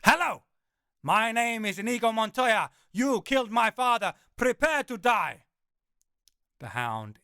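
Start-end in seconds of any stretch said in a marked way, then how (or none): 0.0-5.5 higher, louder, gleeful full-throated Montoya; loud Inigo Montoya. Acted. Narrator taunts the terrified hound, high and loud Montoya. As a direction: belt the famous line with gusto. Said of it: "my smile widening".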